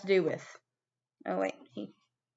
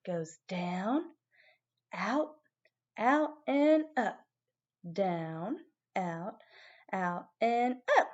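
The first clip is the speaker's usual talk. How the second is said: slow demo counting; 4 dB quieter, much slower than usual. Shown as a demonstration. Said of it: "I want you to hold it".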